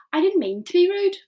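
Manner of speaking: put-on high, apologetic girl voice; little girl voice. A narrator's reading. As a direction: say sorry in a small girl's voice. Sophie apologising.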